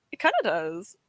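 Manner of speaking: ordinary talk, nothing marked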